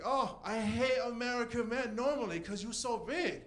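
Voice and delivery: mock a Chinese accent on purpose, badly. Comic retelling Queenie's line in a bad Chinese accent. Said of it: "make a really bad accent".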